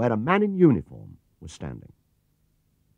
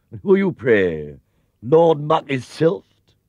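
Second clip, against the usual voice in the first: louder, slow mocking doorman; sneering gatekeeper; 5 semitones higher, 6 dB louder, slower than usual. A narrator's reading. Gatekeeper mocking the bear at the gate, sneering slowly. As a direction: look down your nose at him.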